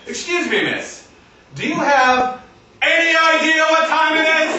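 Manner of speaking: stern parent building to a high yell; disgruntled parent. Acted. Parent scolding teenage daughter out past curfew, disgruntled. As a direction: start as a scolding parent, rise to outrage. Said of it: "as if I am a disgruntled parent".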